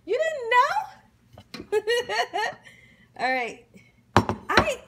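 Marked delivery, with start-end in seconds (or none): 0.0-1.8 high, loud, drawn-out teasing; telling the friend off playfully, high and laughing; teasing brag. Retold or imitated. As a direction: tease them, slowly and pitched up, laughing. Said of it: "And you're gonna say".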